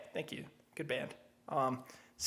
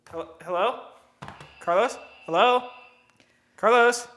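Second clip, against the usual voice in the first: calling out; 3 semitones higher, 6 dB louder, slower than usual. Pretend. Role-played calling into dead line.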